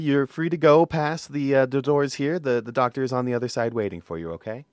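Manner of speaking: quiet, polite front-desk receptionist; soft receptionist voice. Acted. Receptionist softly telling patient Bart to wait.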